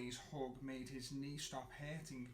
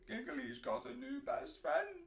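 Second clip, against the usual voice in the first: high, a bit louder tearful line; tearful complaint; 10 semitones higher, 4 dB louder than usual. A narrator's reading. Snuggly pointing at the newcomer, mildly tearful. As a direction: complain through mild tears.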